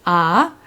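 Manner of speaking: "ah" rising like a question. Shown as a demonstration. Teacher modelling tone two, "ah" climbing up the scale. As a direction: slide "ah" upward, as if going upstairs. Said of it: "deemed as the question tone".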